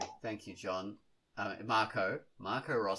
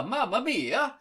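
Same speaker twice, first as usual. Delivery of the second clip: put-on Italian accent; 7 semitones higher, 7 dB louder than usual. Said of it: "my Mario version".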